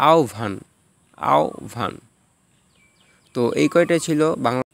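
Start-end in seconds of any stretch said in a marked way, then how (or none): 0.1-1.9 আহ্বান as "ao-bhan"; careful repeated আহ্বান with bh for the written b; bh sound. Shown as a demonstration. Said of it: "বয়ের স্থলে ভ হবে".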